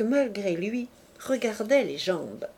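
ordinary delivery, nothing marked

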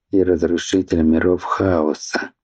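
ordinary delivery

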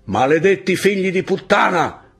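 high, loud yelled curse; enemy cursing the rebels from below, yelling; shouted insult. Acted. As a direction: hurl the insult up the slope. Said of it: "riprese la voce dietro le rocce".

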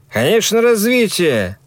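high, sneering agreement; mocking. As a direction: scoff at him, pitched high. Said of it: "снова заулыбался тот".

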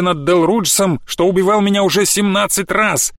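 high, slow heroic rallying cry; rallying speech. Acted. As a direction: stir the crowd to battle. Battle maiden rallying crowd.

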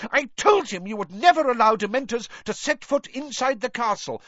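high-pitched, outraged insistence; McGonagall storming. A narrator's reading. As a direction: storm on without letting anyone interrupt. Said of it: "stormed Professor McGonagall".

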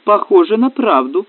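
mildly combative verdict; combative woman's voice. A narrator's reading. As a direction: state it like a woman in command. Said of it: "сказала женщина с боевым голосом".

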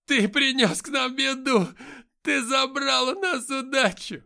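very high, very loud, slow screaming; hysterical screaming. Acted. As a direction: shriek the accusation hysterically. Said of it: "истерично закричала женщина".

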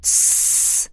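long hissed "shh"; shushing. A narrator's reading. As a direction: hush someone sharply without voice. Someone hushes the praying queen.

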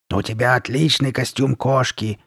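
growly monster compliment; raspy mask voice. Acted. Masked girl praising Sabrina's costume, raspy. Carly Beth in her mask voice.

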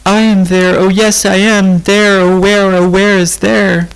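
a frightened tearful lament; crying out. A narrator's reading. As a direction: wail the words in distress. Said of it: "And Rose began to cry".

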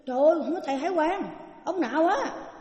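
fast, high, amazed; girl marvelling at the monk, high and quick; excited exclaiming. Retold or imitated. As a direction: gush in astonishment. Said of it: "Nó nói".

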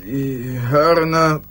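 tief, langsam, wie aus weiter Ferne; verfremdete tiefe Traumstimme. Acted. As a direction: ein dumpfer Albtraum. (tiefe, verzerrte Traumstimme aus der Ferne)

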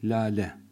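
Lale with a fronted thin a. Shown as a demonstration. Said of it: "A'yı incelttik".

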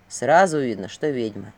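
very low male voice; suspicious guard. A narrator's reading. Guard looking over Vasilisa, low suspicious verdict. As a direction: pronounce it low and knowing.

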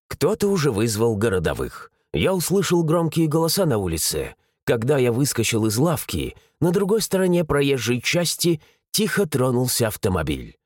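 ordinary delivery, nothing marked

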